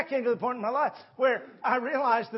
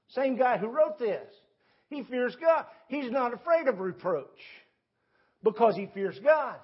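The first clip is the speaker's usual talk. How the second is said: ordinary talk, nothing marked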